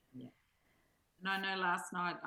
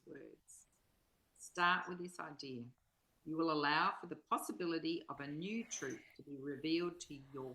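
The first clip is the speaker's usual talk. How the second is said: measured, solemn reading of a spiritual text; inspirational reading; 4 semitones lower than usual. Acted. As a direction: read each line as if offering it to the listener. Reads the passage aloud again.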